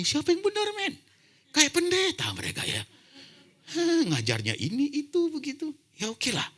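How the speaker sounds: very high, loud, mock-bewildered outcry; comic disbelief at doctors who preach like pastors; exasperated squeal. Real, not acted. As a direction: throw up your hands in a shrill who's-right protest. Exasperated about contradicting doctors.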